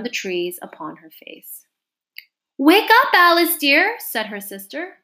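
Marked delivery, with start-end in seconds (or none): 2.6-4.0 high, loud affectionate call; calling to wake. A narrator's reading. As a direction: rouse a sleeper with a raised voice. Sister calls Alice awake.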